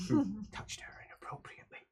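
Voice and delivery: fast, whispered, amused; whispered aside. Real, not acted. Game master whispering a joke aside, amused whisper. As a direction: slip it out in a giggly whisper.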